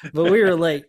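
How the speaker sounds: a sheepish but loud admission; emphatic admission. Retold or imitated. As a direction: punch it out like a confession. Admitting lateness with emphasis.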